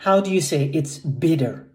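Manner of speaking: usual speaking voice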